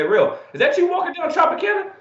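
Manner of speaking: high, teasing fan calling out; imitating a fan. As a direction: play the surprised fan who spotted him. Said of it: "texted me on my Twitter, say".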